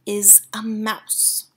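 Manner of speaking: soft, slow, whispered; calm. A narrator's reading. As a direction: say it unbothered. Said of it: "calm as ever".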